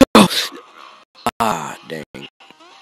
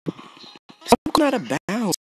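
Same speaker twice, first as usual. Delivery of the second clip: slow, much higher exasperated whine; frustrated whine; 11 semitones higher, slower than usual. Real, not acted. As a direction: drag out the complaint, exasperated. Frustrated with game controls.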